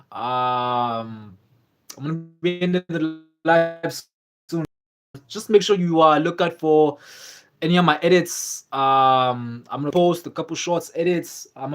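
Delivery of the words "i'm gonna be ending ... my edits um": ordinary talk, nothing marked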